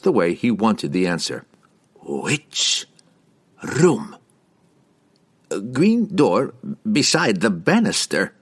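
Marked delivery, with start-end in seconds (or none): 2.0-4.2 each word alone, long pause between; slow and distinct. A narrator's reading. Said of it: "spoke each word of his question slowly and distinctly". 5.7-8.3 slow, higher, intimidated answer; gulping. A narrator's reading. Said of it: "Harkle gulped".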